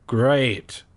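ordinary talk

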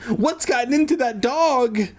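high-pitched alarmed question; crying out. A narrator's reading. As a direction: cry it out in mock alarm. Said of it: "Andy cried".

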